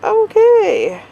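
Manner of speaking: high drawn-out "okay"; mock-impressed, high-pitched reaction; surprised okay. Reacting aloud to own step count.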